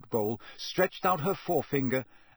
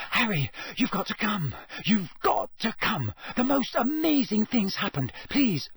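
high, breathless urgent plea; pitched well up, breathless with news; panting; 9 semitones higher than usual. A narrator's reading. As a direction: beg excitedly between pants. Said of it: "she panted".

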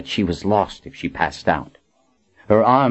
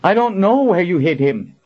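high, urgent call; 11 semitones higher than usual. A narrator's reading. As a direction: call across to a suffering friend. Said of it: "Colin called out to Kara".

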